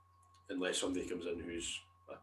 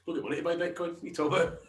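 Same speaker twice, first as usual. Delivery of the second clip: high-pitched, louder outburst of disbelief; incredulous protest; 9 semitones higher, 6 dB louder, faster than usual. Real, not acted. Skint friend answering a question about Bitcoin, incredulous protest. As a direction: protest it high, rising to a loud finish.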